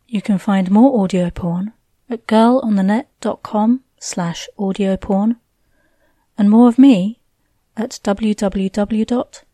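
ordinary delivery, nothing marked

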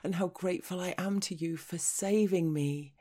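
plain reading voice

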